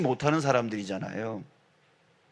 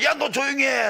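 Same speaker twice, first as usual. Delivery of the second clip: high, raised-voice mock rebuke; shouted scolding; 11 semitones higher than usual. Crowd scolding the blind man, high sharp shout. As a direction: shush him with an acted yell. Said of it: "라고 꾸짖었을 때".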